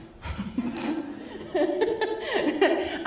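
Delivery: high laugh after a joke. Real, not acted. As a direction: laugh at your own joke.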